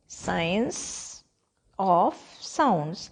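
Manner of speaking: "Science... of... sounds", long pauses between words; word-by-word dictation. Shown as a demonstration. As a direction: dictate "Science of sounds" one word at a time, softly starting. ("Science of sounds" को रुक-रुक कर, एक-एक शब्द बोलें)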